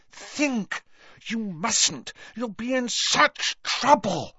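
high-pitched, urgent begging; desperate, high warning; frantic pleading. A narrator's reading. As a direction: implore a friend in a high, anxious voice. Friend begs him not to fight.

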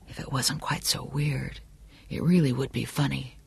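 hushed private thought; inner musing. A narrator's reading. Johnny uneasy about the candidate's guards, softly musing to himself. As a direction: drop to a soft aside to oneself.

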